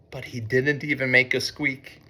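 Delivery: usual speaking voice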